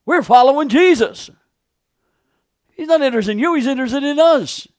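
high, loud, contemptuous dismissal; haughty followers brushing him off; scornful crowd. Acted. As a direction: sneer the beggar away. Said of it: "They looked at him as".

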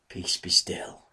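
breathy hush, low and soft; whispered words of command to the wind and waves. Acted. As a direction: still the sea with a whisper. Jesus commands the storm.

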